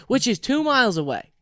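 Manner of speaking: ordinary talk, nothing marked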